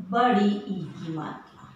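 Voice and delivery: ordinary delivery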